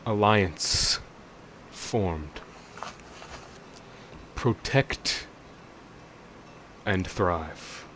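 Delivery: slow, grating, distant voice; metallic voice. Acted. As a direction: speak slowly from the abyss. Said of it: "you can hear this metallic voice".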